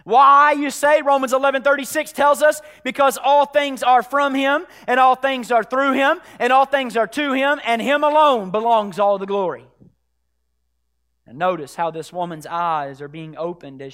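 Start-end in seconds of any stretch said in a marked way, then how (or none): 0.0-9.7 loud, raised preacher's proclamation; full-force preaching. Real, not acted. Preacher quoting Romans to the church, full-force preaching. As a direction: preach it out with rising conviction.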